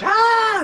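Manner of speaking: loud, piercingly high imitated shriek; mock scream. Too much of sister's yelling in your life, mock scream. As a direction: burst into a mock scream mid-sentence. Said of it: "you don't need too much".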